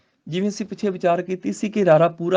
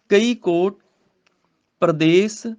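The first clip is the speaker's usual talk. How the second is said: slow, measured verse reading; scripture recitation; 3 dB louder, slower than usual. Shown as a demonstration. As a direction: recite the sacred verse, drawing out the words.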